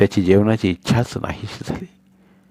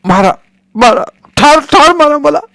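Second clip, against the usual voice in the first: frenzied scream; 8 dB louder than usual. Acted. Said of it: "जास्त चवतावून ओरडला".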